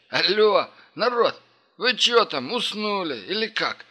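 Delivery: high, slow call through a door. Acted. Banging on door, calling out.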